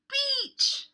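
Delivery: high, loud cry of Beach; excited. Acted. Four friends cheering their plan together, loud and high. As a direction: shout it like the best idea ever. Kids all shout the idea.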